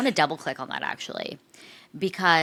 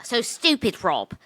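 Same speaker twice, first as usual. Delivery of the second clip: exaggerated Irish accent, pitched up; mock Irish accent, higher and lamenting; Maura's accent; 6 semitones higher, 6 dB louder than usual. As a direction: imitate an Irish reality contestant's accent. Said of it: "do her accent like you can".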